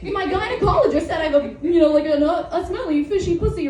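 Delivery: high, mocking joke-teller voice; mock comedian. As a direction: parody a comic telling a made-up bit. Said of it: "sometimes people, like, lie in comedy. They say".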